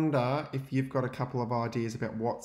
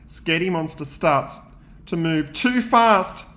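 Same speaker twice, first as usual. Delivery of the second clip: higher and louder, building panic; rising alarm; 8 semitones higher, 10 dB louder than usual. A narrator's reading. Teacher reading the crash to class, higher, louder and alarmed. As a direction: speed up the worry as he loses control.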